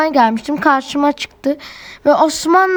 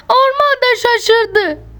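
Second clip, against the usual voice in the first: high, slow, amazed; squealy delight; excited; 8 semitones higher, slower than usual. Real, not acted. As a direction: marvel at it. Surprised reaction narrated.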